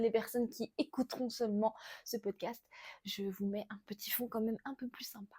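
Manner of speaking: soft whispered aside; whispering. Real, not acted. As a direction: whisper it to the listener. Whispered aside about background.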